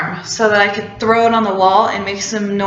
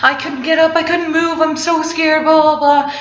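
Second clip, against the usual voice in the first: higher, pleading mock panic; a higher, hurried retelling of being scared; retold panic; 7 semitones higher than usual. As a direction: replay your own frantic insistence. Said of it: "And I was like".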